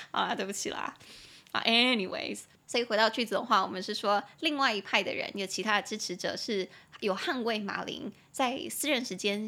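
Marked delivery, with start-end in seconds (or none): none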